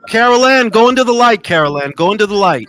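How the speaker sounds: loud mock-dramatic film line; calling out. Host heckles a guest with a film line, calling out loudly. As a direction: shout it like calling someone from afar.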